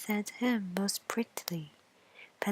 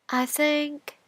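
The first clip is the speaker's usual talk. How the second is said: higher, louder bean voice; bean character; 6 semitones higher, 5 dB louder than usual. Acted. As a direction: begin thoughtfully as the bean. Bean voiced in fairy tale.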